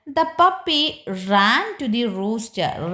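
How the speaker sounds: put-on drawn-out sentence read aloud for children; slow story reading. Shown as a demonstration. Teacher reads to children, slow and drawn out. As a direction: read the story line slowly to the class.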